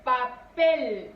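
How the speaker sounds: papel loud, split into "pa" and a strong "pel"; stress on final syllable. Shown as a demonstration. (papel fuerte, por sílabas, acentuando "pel")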